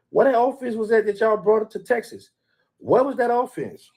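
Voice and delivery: high, incredulous taunt; mocking disbelief. Real, not acted. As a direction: jeer at their offense like you can't believe it. Mocking rival fans' weak offense.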